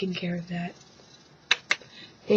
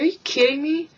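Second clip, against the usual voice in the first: high, loud disbelief; incredulous; 8 semitones higher, 6 dB louder than usual. Retold or imitated. Kid hearing a weird name, loud incredulous. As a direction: balk at the name. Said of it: "I was like".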